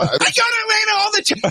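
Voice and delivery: high shrill whine; very high mocking squeal; cry of a liar. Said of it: "the cry of a liar".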